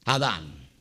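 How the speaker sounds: low quiet echo. Shown as a demonstration. Preacher echoing his own answer, low and soft. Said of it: "¿qué?".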